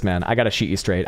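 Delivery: ordinary talk, nothing marked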